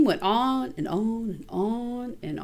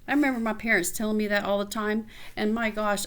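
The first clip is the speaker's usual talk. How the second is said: usual speaking voice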